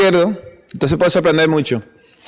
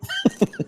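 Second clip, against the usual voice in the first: high burst of laughter; 8 dB quieter than usual. Real, not acted. Laughing at his own joke.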